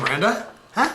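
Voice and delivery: usual speaking voice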